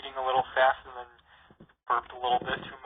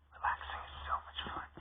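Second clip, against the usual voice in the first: toneless whisper, barely there; gloomy whisper; 9 dB quieter than usual. Acted. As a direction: breathe it out with no enthusiasm at all. Gloomy goth sister speaks.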